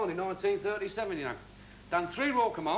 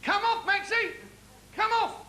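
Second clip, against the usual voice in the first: much higher, louder stage manager yelling to get him off; mock shout from the wings; 13 semitones higher, 4 dB louder than usual. Comedian imitating the stage manager yelling from the wings, louder and higher. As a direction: bellow it like a stagehand losing patience. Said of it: "he starts shouting at me from the wings".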